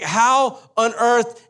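high, drawn-out incredulous cry; imitating a flabbergasted dad; exasperated disbelief. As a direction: stretch it out high in mock outrage. Dad's exasperation, acted out.

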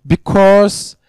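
loud, high, driving sermon voice; fervent preaching. Acted. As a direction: preach it out, rising and insistent. Preaching at full force.

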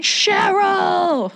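high, drawn-out mock scream; screaming at someone. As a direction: imitate a man wailing a name across water. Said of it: "he's screaming at the top of his lungs".

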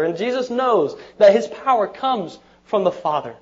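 full-force pulpit preaching; fervent preaching. Acted. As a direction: preach it with building fire.

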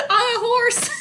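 high, gleeful laughing cry; delighted squeal of a detail; laughing exclamation. Real, not acted. Gleefully adding iconic detail.

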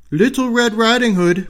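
wolf pretending to be the little girl; wolf faking the girl. Wolf at grandmother's door posing as the girl. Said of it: "replied the wolf".

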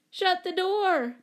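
high, drawn-out yell; bellowing. A narrator's reading. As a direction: bellow it as an urgent order. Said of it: "bellowed Dawn".

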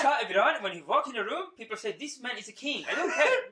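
put-on high, excited Iranian-accented boasting. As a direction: boast about your king in an Iranian accent. Acted Iranian admirer praising the Shah.

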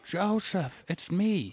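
put-on old person's voice. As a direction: play an elderly version of yourself. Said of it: "with an old person voice".

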